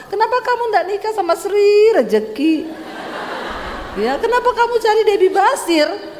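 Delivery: high-pitched indignant retort; quarrelling wife. Acted. Wife challenging husband's choice of bride, high indignant retort.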